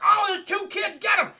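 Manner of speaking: high loud cartoon goon shout; mock yell. Henchmen spot the kids.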